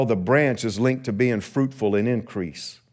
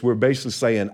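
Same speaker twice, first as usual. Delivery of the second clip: normal talking voice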